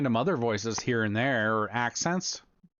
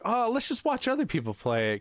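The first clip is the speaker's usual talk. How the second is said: skeptical; 11 semitones higher than usual. Retold or imitated. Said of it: "I'm like".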